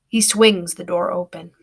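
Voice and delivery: ordinary delivery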